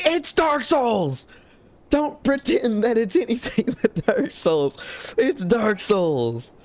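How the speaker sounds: high, drawn-out protest with a laugh, easing down; exasperated insistence. Real, not acted. Streamer calling out the game as a copy, exasperated. Mock outrage over the game's identity.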